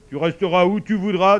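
forceful projected contradiction; insistent sun. Acted. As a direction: insist loudly in character as the sun. Narrator voices the sun.

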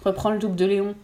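plain reading voice

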